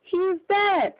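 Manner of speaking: high, loud alarmed cry. A narrator's reading. Bystanders crying out to the crowd, high and loud. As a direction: call out that he is dead. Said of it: "cried several voices".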